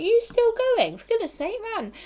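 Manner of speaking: high-pitched exasperated retort. Retold or imitated. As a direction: act out fed-up disbelief. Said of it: "I was like".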